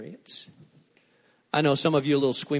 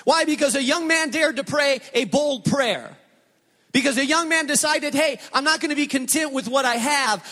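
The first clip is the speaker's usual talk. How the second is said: preaching at full force; 9 semitones higher than usual. Acted. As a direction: preach it out with rising fire.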